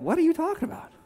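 high, baffled question; mock incredulity. God answering someone twisting His arm, mock incredulity. Voices God's baffled reply.

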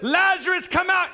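high-pitched summons into heaven; calling from nowhere. Acted. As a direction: call someone back from far away. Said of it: "suddenly you hear a voice out of nowhere".

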